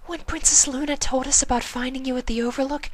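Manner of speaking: near tears, sniffling; tearful Fluttershy. A narrator's reading. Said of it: "the sniffling mare".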